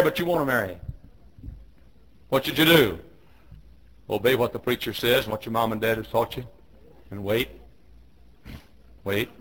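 ordinary delivery

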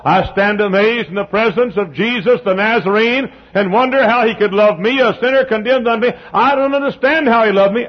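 high, loud preacher climb; preaching at full force. Real, not acted. Preacher lifting the congregation, rising and ringing. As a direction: build the sermon to a ringing peak.

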